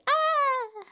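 short high-pitched frightened yelp; high mock scream. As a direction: shriek in mock terror. Everyone hears voice of God.

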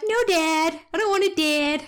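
Otis. Shown as a demonstration. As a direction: play a reluctant pet pleading with Dad. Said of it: "I give Otis a voice".

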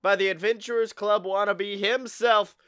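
loud, drawn-out ringmaster intro; show announcer. Acted. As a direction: stretch the key words, building to the name. Podcast host's show introduction.

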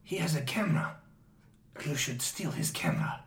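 low whispered scheming; conspiratorial whisper. Acted. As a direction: whisper it as a sneaky prompt. Inner voice urging George in secret.

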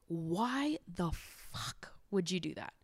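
whispered, unvoiced disbelief; mock whisper. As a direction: hiss it under your breath in disbelief. Imagined reaction to cheating friend.

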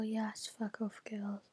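soft cheeky whisper; whispered retort. Real, not acted. Vlogger answering a viewer question in a whisper. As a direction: whisper it playfully rude.

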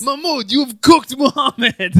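high, loud shocked cry; shocked outburst. Retold or imitated. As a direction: yell in disbelief at your buddy. Acting shocked fighter in story.

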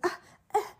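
small high imitated coughs; mock coughing. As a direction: cough lightly, acting it out. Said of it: "like, coughing".

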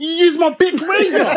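high, loud outraged mum. Retold or imitated. Imitating mum scolding him over her razor, high and loud. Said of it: "She said".

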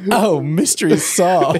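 giggling through the quoted line; amused mock snooty quote; laughing quote. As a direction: laugh while repeating it.

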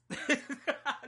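high, sudden burst of real laughter. Real, not acted. Host catching themself after a long rant, laughing. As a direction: break up laughing at yourself.

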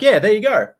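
high, loud imitation of a trainer; upbeat retold sign-off, easing down at the end; cheery send-off. Trainer's breezy send-off, acted.

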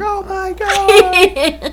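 very high, drawn-out mock squeal; awestruck squeal, imitated. Friend being shown the engagement ring, imitated awestruck squeal. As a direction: stretch it out loud and high, imitating a thrilled friend.

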